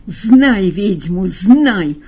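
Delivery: loud, slow witch's wail. Acted. Margarita wailing at the informer, slow and loud. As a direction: drag it out like a howling witch. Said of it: "завывая".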